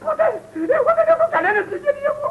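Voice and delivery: high, wailing protest; tearful outburst. Acted. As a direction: disown them all in a sobbing wail. Disowning his relatives in distress. (κλαψιάρικο ξέσπασμα)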